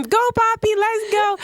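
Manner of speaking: high-pitched pretend fan cheer; playful high whoop; mock cheering. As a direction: cheer like a fan holding a sign. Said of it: "I'm gonna be like".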